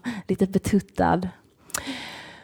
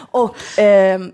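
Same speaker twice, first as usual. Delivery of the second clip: ordinary delivery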